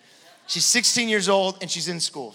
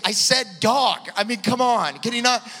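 imitating a fed-up wife, higher and louder; exasperated wife; 7 semitones higher, 7 dB louder than usual. Wife repeats herself, fed up.

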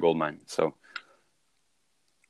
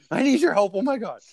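high, strained desperate plea; panicked customer; 13 semitones higher than usual. Retold or imitated. Picturing a frightened client begging for help, high and strained. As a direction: plead like someone just diagnosed. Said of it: "you can picture it".

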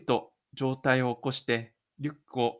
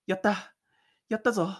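usual speaking voice

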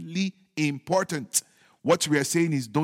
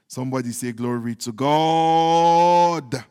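rallying call with the last word held long and loud; congregation call; 2 semitones lower, 6 dB louder, slower than usual. Real, not acted. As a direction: draw out the praise for the crowd to join. Preacher calls for congregation's response.

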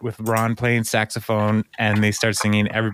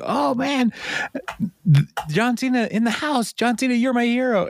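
much higher, starstruck fanboy squeal; imitating Andy; 13 semitones higher than usual. Andy meeting his hero John Cena, high starstruck gushing. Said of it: "And Andy's like".

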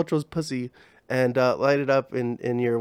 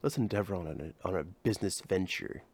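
softer and lower, a mock seductive murmur; low bedroom voice; 6 semitones lower, 9 dB quieter than usual. Man purrs a business idea to Gwyneth, mock seductive. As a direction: purr it low like pillow talk. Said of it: "That's how you talk when you're having sex".

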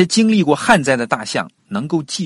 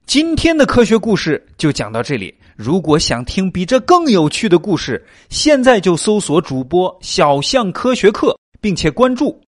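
podcast outro; 6 semitones higher, 5 dB louder than usual. Acted. Channel outro and call to follow.

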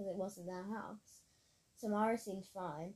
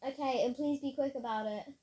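raised, higher-pitched plea; calling back; 5 semitones higher, 4 dB louder than usual. A narrator's reading. Friend tumbling downhill answers rescuer, urgent raised voice.

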